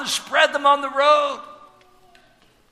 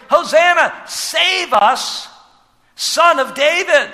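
louder, higher plea; crying out to be saved; 5 semitones higher, 5 dB louder, slower than usual. Retold or imitated. Preacher voicing the crowd crying out, louder plea. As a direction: call out like the crowd pleading. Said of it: "what the people are crying out".

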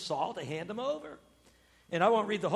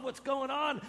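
normal talking voice

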